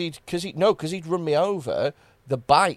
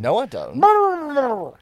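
indignant protest; 11 semitones higher, 5 dB louder, much slower than usual. Real, not acted.

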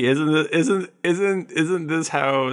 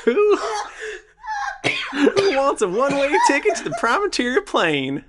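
high giggly priest voice; 12 semitones higher than usual. Acted. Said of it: "and he goes".

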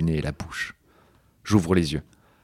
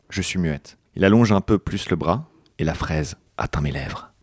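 usual speaking voice

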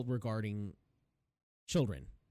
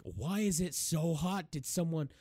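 slow suspicious question; 6 semitones higher, slower than usual. Retold or imitated. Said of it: "he's like".

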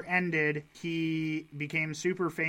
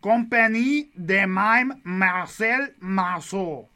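French accent; 4 semitones higher, 7 dB louder, slower than usual. Real, not acted. Reading French name with accent.

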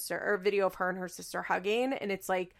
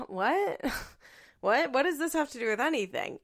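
high, baffled questioning; laughing disbelief, pitched up; 5 semitones higher than usual. Retold or imitated. Acting out her confusion at irrelevant old videos, baffled.

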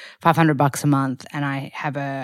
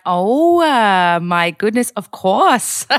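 high, loud "oh" easing into agreement; flattered surprise; 4 semitones higher, 4 dB louder, much slower than usual. Retold or imitated. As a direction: play up the flattered, eager yes. Acting out her flattered reply.

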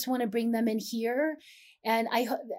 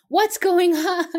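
loud, high, drawn-out mock outcry; exaggerated outcry; 8 semitones higher, 9 dB louder, slower than usual. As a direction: overdramatic cry of bewilderment, half laughing. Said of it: "I didn't say it like".

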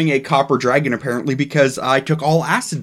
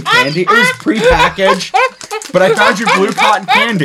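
high and louder while laughing; 4 dB louder than usual. Real, not acted. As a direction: giggle through the excuse. Laughing excuse for hard candy.

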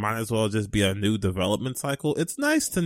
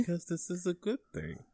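very soft, higher forced optimism; small voice badly faking approval; unconvincingly positive; 4 semitones higher, 11 dB quieter than usual. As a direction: talk yourself into it quietly. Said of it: "You don't sound happy, though".

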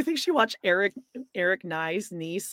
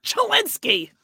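Babs voice; 13 semitones higher, slower than usual. Retold or imitated. Babs voice callout.

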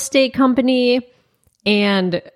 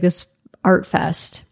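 ordinary talk

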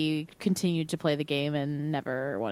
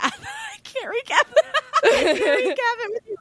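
laughing through the words; giggling while speaking; 5 dB louder than usual. Real, not acted. Laughing about missing the recap.

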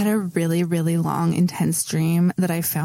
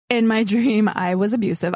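ordinary talk, nothing marked

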